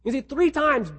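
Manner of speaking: normal talking voice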